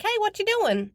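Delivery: put-on high, playful toddler voice; imitating a small boy on a video call; little boy voice. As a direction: chirp it like a tiny kid. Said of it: "and he's like".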